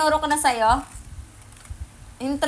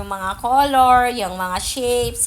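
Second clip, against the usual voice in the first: slow teaching; slower than usual. Shown as a demonstration. Parent teaching baby words.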